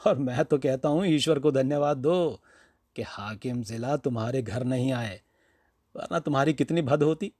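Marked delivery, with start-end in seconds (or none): none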